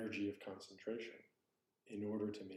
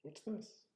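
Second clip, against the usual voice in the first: softer question rising high on THIS; question tune; 10 semitones higher, 3 dB quieter than usual. Shown as a demonstration. Teacher demonstrating a koan phrase, as a high rising question. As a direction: ask "What's this?" lightly, pitch lifting. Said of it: "It doesn't have to be".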